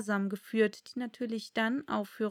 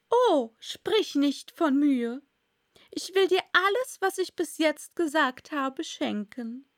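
gushing duchess voice; 5 semitones higher, 5 dB louder than usual. A narrator's reading. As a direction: lay the generosity on thick. Duchess speaking in story.

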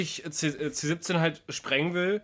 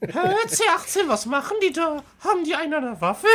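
squeaky Krillin voice; 11 semitones higher than usual. Retold or imitated. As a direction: play a clueless squeaky sidekick, laughing. Imitiert Krillin, quatschige hohe Stimme. (viel höher, langsam, quietschig verwirrt, lachend)

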